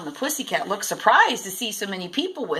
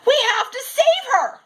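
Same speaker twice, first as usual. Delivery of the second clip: put-on high, loud boy's shout; 5 dB louder than usual. A narrator's reading. Zach shouting to save the tangled mermaid, loud and high. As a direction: yell it out in alarm. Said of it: "shouted Zach".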